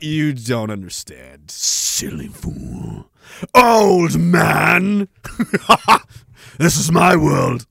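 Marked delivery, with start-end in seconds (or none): 0.0-3.1 low, slow, hushed sneer at a fool; sneering old man. Acted. 3.5-6.6 mock proud shout. Proclaiming himself old man.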